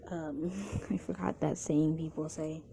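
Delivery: ordinary delivery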